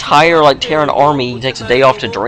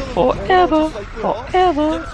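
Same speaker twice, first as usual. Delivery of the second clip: drawn out; slower than usual. Real, not acted. Tired caster stretches word.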